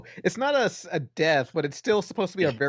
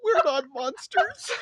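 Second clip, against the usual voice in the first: high, slow, weepy wail; hammed-up crying through laughter; mock sobbing; 9 semitones higher, slower than usual. Mikey answering the police, mock-tearful whine. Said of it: "He's not, like, crying or sobbing".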